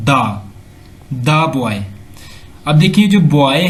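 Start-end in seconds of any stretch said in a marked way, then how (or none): 0.1-1.8 put-on "the boy" with "the" said as open "daa". Shown as a demonstration. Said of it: "pronunciation will be".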